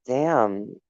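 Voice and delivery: ordinary talk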